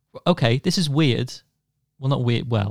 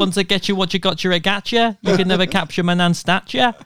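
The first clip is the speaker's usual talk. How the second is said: higher, gangster granny threat; tough granny voice; 6 semitones higher than usual. Retold or imitated. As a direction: play a tough old nan with a gun. Impersonating armed nan.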